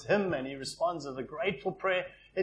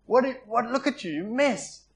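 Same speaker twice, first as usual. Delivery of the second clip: disgusted scolding; 7 semitones higher than usual. Retold or imitated. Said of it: "That's what I would expect".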